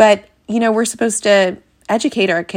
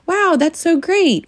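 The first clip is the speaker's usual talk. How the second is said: pitched up, slow gushing wow; high mom-voice praise; 10 semitones higher, slower than usual. Shown as a demonstration. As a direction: overdo the praise like a cheerleader. Generic praise to avoid.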